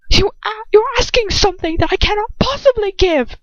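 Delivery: high, strained shout. Acted. Lucius shouting at her in the cell, high and strained. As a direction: throw it at her in anger. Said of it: "he shouts".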